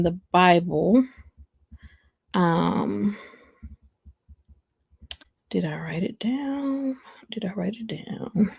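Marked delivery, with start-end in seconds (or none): 5.5-8.5 soft, absent whisper; whispering to self. Real, not acted. Streamer asking herself about her notes, whispering. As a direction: whisper it privately while checking.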